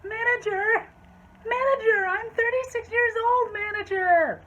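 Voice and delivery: very high, loud mock holler; hammed-up wailing tantrum; yelling. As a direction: shriek over everyone like an outraged customer. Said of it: "she's just yelling".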